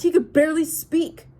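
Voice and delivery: loud, high angry retort; snapping. Acted. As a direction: keep snapping, loud and bitter. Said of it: "I snapped".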